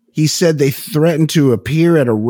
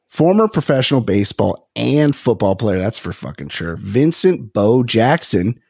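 ordinary talk, nothing marked

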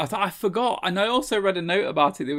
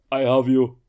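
low, louder imitation of Arnold; Arnold impression; 4 semitones lower, 6 dB louder than usual. Hercules holding a man up, imitated Arnold voice.

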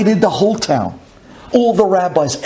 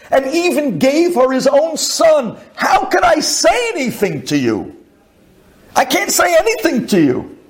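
higher, rising to a cry of anguish; emotional outburst; 10 semitones higher than usual. Retold or imitated. Jilted poor man telling the butcher his pain, high and anguished. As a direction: let it break high and anguished.

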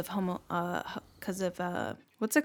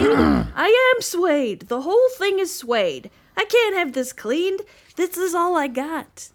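voiced suede cow; 6 semitones higher, 7 dB louder than usual.

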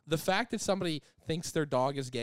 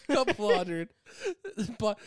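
laughing; 9 semitones higher, 4 dB louder than usual. Real, not acted.

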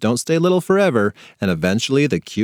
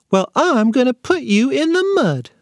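put-on much higher, childish little-boy voice; toddler threat voice; 12 semitones higher than usual. A narrator's reading. Little brother threatening his sister in a high toddler voice. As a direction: pipe it up like a tiny tot.

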